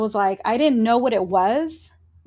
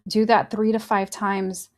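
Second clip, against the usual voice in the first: guided breathing; 2 semitones lower than usual. Acted. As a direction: talk them through each breath.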